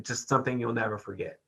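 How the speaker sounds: normal talking voice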